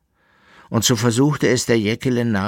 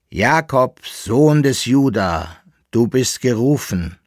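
slow, drawn-out, raised calling; summoning voice; 4 semitones higher, 6 dB louder than usual. A narrator's reading. A mysterious voice summons Jakob by name, solemn calling. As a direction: call him solemnly, stretching the name. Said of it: "kam die erste Stimme wieder".

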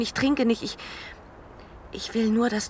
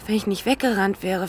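ordinary delivery

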